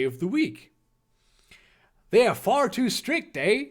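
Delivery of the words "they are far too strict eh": put-on higher-pitched, makeshift Canadian accent. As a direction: do an amateur Canadian accent ending on "eh?". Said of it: "Canadian accent".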